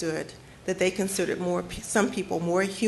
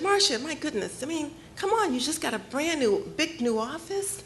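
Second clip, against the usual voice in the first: high, laughing, patronizing boss voice; dismissive supervisor, mocking and amused; condescending brush-off; 7 semitones higher than usual. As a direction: pitch way up and laugh her off.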